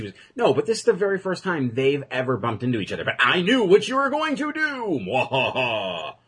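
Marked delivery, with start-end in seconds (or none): 3.0-5.0 high, gloating cartoon villain; sneering panto villain. Mock villain gloating quote. 5.1-6.1 slow hammy mwahaha; evil villain laugh. Pretend. Mock villain gloating quote.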